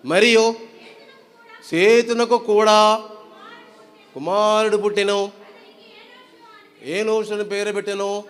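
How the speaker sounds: slow, declaimed Bible verse; scripture reading. Acted. Preacher reading Genesis to the congregation, slow and declaimed. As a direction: read it out phrase by phrase, drawing out the endings.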